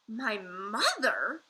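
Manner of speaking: slow, loud astonished cry; surprised cry. A narrator's reading. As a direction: cry it out in disbelief. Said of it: "cried the B F G".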